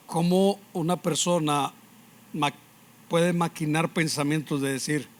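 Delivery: ordinary talk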